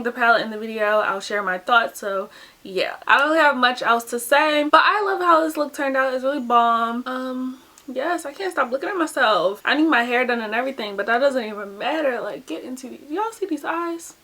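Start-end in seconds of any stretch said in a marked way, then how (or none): none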